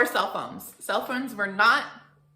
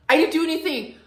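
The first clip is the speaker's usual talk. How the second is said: high, loud protest; frantic self-defence; 9 semitones higher, 8 dB louder than usual. Retold or imitated. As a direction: act out a teen pleading innocence to mom. Daughter defends herself to mother.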